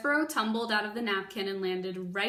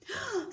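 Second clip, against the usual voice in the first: gasp; 11 semitones higher than usual. Acted. Reaction in story.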